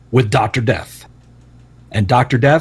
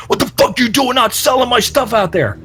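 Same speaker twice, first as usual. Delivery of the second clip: mock yelling; 8 semitones higher than usual. Garvin berates Cornette after the match, mock yelling. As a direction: yell it in imitation of an angry wrestler. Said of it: "Garvin is yelling at Cornette".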